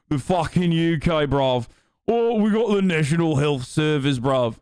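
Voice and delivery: hammed-up mock British accent. Streamer mocking Britain and its health service, fake UK accent. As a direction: put on an exaggerated British accent. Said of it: "this is the U K accent".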